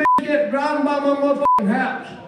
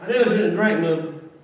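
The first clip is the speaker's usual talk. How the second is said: normal talking voice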